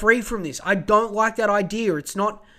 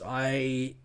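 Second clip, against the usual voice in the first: low, heartbroken and subdued; really upset; 6 semitones lower than usual. Real, not acted. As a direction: let the hurt pull the voice down. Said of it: "I'm getting really upset over this".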